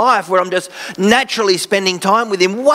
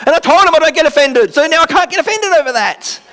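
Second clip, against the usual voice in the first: mock indignant; 10 semitones higher, 4 dB louder than usual. Mocking own past logic.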